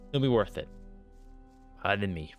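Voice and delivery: ordinary talk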